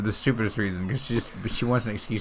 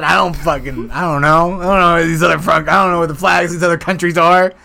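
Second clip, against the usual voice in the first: louder, higher fierce American rant; patriotic rant; 8 semitones higher, 10 dB louder than usual. Retold or imitated. Fiancée insisting she only knows her flag, loud rant. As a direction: rant like a proud flag-lover. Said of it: "She said".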